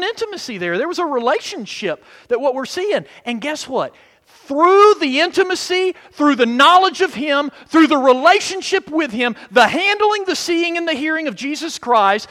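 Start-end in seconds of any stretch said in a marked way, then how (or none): 4.2-12.3 rising, louder preaching build; preaching crescendo. Acted. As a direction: build the list toward a peak.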